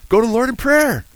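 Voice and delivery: high, loud exhortation; ringing preacher's plea; fervent preaching cry. Real, not acted. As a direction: call it out to the whole room.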